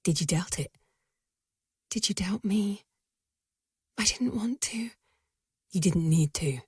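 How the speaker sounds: hushed whispered exchange; soft unvoiced reunion lines. A narrator's reading. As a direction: keep it down in the library. Hushed library reunion dialogue.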